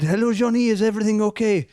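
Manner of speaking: high, bright mock French accent for Severine; the French partner answering Johnny's late-night call in a mock French accent. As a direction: put on a hammed-up French accent, pitched well up. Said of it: "put on the same French accent that I'm putting on".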